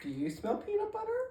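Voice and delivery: very high silly voice; high squeaky question. Pretend. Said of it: "random bit".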